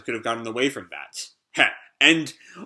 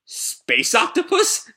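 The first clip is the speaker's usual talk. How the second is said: amazed; 10 semitones higher, slower than usual. Acted. Shiki amazed at tentacle men.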